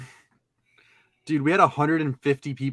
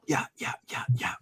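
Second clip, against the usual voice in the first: whispered yeah yeah; whispering; 2 semitones lower, 6 dB quieter than usual. Real, not acted. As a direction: breathe it out unvoiced. Whispered after beatbox.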